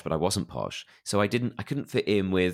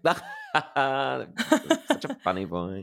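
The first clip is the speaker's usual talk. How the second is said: higher, drawn-out laughing quote; imitated amused onlookers; 6 semitones higher, much slower than usual. People laughing at an anxious child's remark, imitated amusement.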